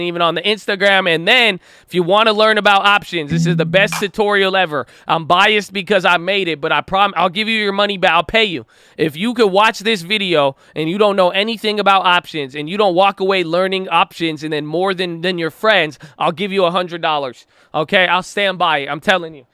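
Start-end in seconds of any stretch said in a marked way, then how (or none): none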